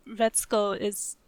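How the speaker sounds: ordinary talk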